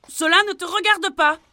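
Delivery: cri plus aigu et bien plus fort, insolent; envoie-le promener en criant; crié. Acted. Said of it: "cria Pierre". (Pierre crie au cavalier)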